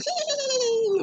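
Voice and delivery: high, soft tittering "hee hee"; soft high mock titter; mocking giggle. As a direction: mimic the coworkers' snide little giggle. Mocking coworkers giggling in corner.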